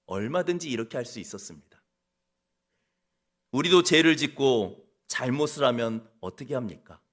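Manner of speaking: ordinary talk, nothing marked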